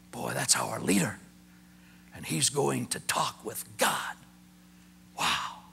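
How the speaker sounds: whispered in hushed wonder; awed whisper. Shown as a demonstration. Preacher whispering in awe to the congregation. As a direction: hold the room in a hush.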